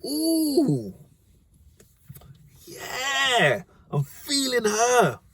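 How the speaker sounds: high, slow, drooling lovestruck voice; smitten schoolboy. Retold or imitated. English boy eyeing Stephanie, high lovestruck croon. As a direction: leer over the girl in a high swoony voice. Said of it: "He's like".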